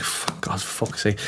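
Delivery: muttered swearing under the breath; low unvoiced swearing as the car limps in; hushed curse. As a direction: curse softly in relief and dread. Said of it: "and I was like".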